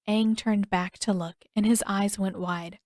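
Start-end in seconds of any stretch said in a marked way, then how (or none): none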